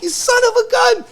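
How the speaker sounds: high, loud, put-on cry of mock outrage. To his dad who tricked him, mock outrage. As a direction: squeal it like you've been had.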